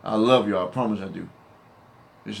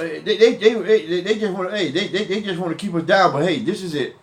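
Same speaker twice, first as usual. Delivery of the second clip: ordinary delivery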